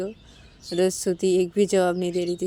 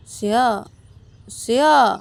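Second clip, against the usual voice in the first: insistent call, growing louder; 4 semitones higher, 7 dB louder, much slower than usual. A narrator's reading. Shruti calling Siya who has turned away, insistent. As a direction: draw the name out, rising in force. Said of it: "Siya को बुलाने लगती".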